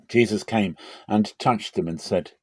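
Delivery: ordinary delivery, nothing marked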